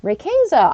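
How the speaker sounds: "Rayquaza" as ray-KAY-za; alternative pronunciation. Shown as a demonstration. Trying another option aloud, "Rayquaza" as ray-KAY-za. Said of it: "I could see that being an option too".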